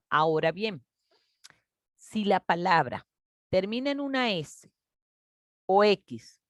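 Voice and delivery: usual speaking voice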